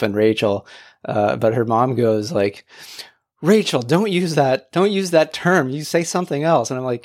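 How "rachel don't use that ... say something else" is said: higher-pitched reproving mom; mildly mock-scolding, emphatic; imitated motherly scolding. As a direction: act out a mom correcting her daughter. Acts mom scolding her daughter.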